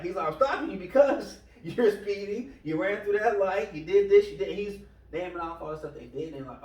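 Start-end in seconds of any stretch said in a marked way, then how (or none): 0.3-4.6 louder, higher mock exasperation; imitating a frustrated officer, building in volume; retold frustration. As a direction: reel off offences like a fed-up cop. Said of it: "he gets kind of frustrated with this".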